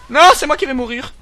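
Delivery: loud, high panicked outburst; hammed-up hysterical protest; mock meltdown. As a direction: cry it out like a sudden crack-up. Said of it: "C'était un petit craquage psychologique".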